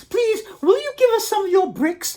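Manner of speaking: squeaky cubs pleading for bricks; little wolves asking. Acted. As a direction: wolves ask the kangaroo for bricks, very high. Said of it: "asked the three little wolves".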